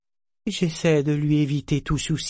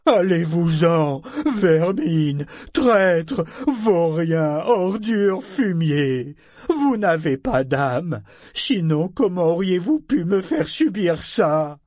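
aigu et fort, insultes crachées; insultes furieuses; 6 semitones higher, 4 dB louder, slower than usual. A narrator's reading. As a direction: chasser la vermine avec rage. Navire fou insulte ses visiteurs. (insultes hurlées avec rage)

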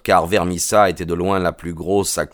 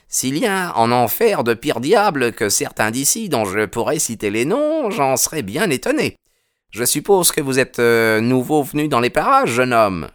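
put-on older policeman's voice, pitched higher; a policeman sizing up a stranger; gruff policeman; 7 semitones higher than usual. Policeman warning the young newcomer, higher voiced.

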